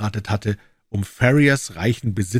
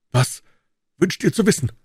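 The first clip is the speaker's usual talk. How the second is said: high, nervous question, glancing around; anxious question; 11 semitones higher, 3 dB louder than usual. A narrator's reading. Cowper, scared of spies, asks Jefferson nervously. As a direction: ask fearfully, afraid of being overheard. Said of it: "sich ängstlich umblickend".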